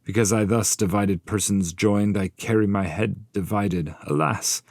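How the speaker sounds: high, loud voice of the headless shade; tormented Bertrand. Acted. Bertrand de Born shows his severed head, high loud lament. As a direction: proclaim the penalty with grief.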